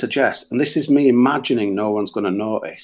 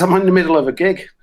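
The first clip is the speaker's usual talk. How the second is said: fast, louder retort; loud and rushed; 6 semitones higher, 4 dB louder, faster than usual. Retold or imitated. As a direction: shout it back in a hurry. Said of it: "I says".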